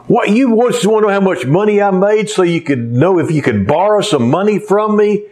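put-on a father scolding his boy; upset dad. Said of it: "the guy got all upset again".

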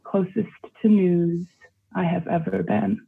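usual speaking voice

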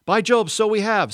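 very high, loud cry; roused, jubilant storybook child; excited exclamation. Acted. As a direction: burst out in delighted agreement. Scrub rejoices at breaking spell.

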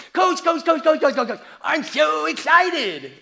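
high, loud, hammed-up teen excitement; excited teenage athlete. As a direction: imitate an eager teenager bursting with news. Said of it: "this is my teenage, any teenager, mouth full of braces".

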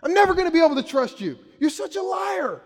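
high, loud shouted outrage; furious outburst. Retold or imitated. Dad blowing up at his kid over a lie, furious shout. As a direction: blow up, yelling in a high pitch. Acted outburst at confessing child.

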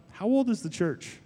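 a hint of the friend's playful musing; gentle teasing question with no accent; whimsical. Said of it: "kind of making this whimsical".

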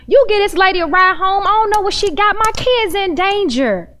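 normal talking voice

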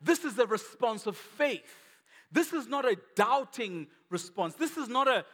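high, loud, driving sermon delivery; impassioned preaching. Real, not acted. Angel telling shepherds of the real sacrificial lamb, preached passionately. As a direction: preach it with rising force, hammering the key words.

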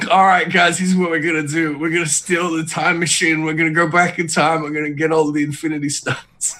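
rally the team like a movie heist boss; mock heist leader. Emet-Selch briefing his crew, hammed-up leader. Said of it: "It's like".

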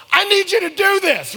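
very high, loud plea; desperate mock yell. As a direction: yell it as a hammed-up, urgent demand. Pleading with friend on cliff.

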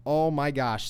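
usual speaking voice

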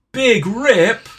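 loud, high mock child's jeer. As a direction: call it out like a heckling child. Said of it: "loudly went".

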